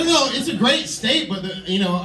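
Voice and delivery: usual speaking voice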